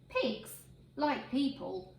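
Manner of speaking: plain reading voice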